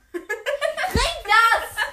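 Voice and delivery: high laughing yell; laughing shout. Real, not acted.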